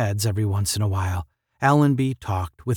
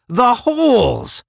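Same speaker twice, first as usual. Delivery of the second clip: loud, high, stretched exclamation; ringing cry of discovery, falling at the end; excited realisation; 13 semitones higher, 7 dB louder, slower than usual. A narrator's reading. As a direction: call out the sudden answer, drawing it out. Second character exclaims the same realisation.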